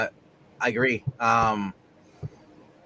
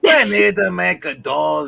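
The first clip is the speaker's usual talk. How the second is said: put-on Irish accent; 3 semitones higher, 7 dB louder, slower than usual. Irish cop voice for a comic.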